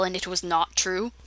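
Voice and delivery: ordinary delivery, nothing marked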